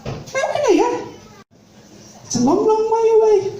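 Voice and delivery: high, plummy posh lady voice; posh society lady greeting the jobseeker. Retold or imitated. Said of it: "She said".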